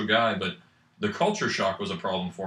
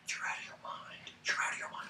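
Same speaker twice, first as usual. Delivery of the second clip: very soft, voiceless whisper; mock indignant whisper; 14 dB quieter than usual. Student retelling his reaction to the accent remark, whispered. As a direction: whisper it in disbelief, twice over.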